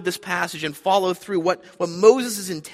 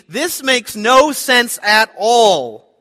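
higher, louder, slow and pleading in disbelief; Abraham's troubled thoughts; 6 semitones higher, 8 dB louder than usual. Retold or imitated. Preacher voicing Abraham's inner protest, higher and louder. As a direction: voice a bewildered father who cannot make sense of it. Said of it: "he probably is thinking".

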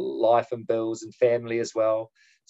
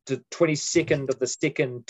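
usual speaking voice

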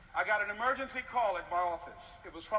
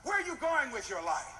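ordinary talk, nothing marked

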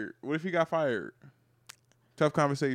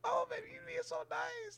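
soft, very high falsetto; mock girlfriend; 16 dB quieter, slower than usual. Girlfriend accepting boyfriend's pampering, soft mock falsetto. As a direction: put on a quiet, cooing woman's voice. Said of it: "She's like".

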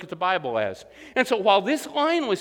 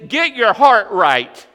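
loud and slow, each word hammered; booming pulpit command; emphatic preaching; 9 dB louder, much slower than usual. Real, not acted. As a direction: drive it home to the congregation. Preacher driving home the point.

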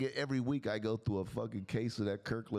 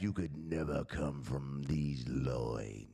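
low, very slow country voice; deep country drawl; 9 semitones lower, much slower than usual. Retold or imitated. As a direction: drop deep and stretch it slowly. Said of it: "You, Jack".

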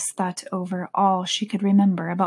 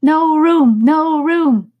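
high, loud cry of the tea party hosts; 8 semitones higher, 8 dB louder, much slower than usual. Acted. The March Hare and Hatter cry out at Alice, high and loud. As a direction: shout it up high in a strange voice. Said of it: "they cried out".

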